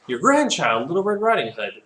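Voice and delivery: put-on high sing-out child reply; little girl answering. Acts child answering at the door.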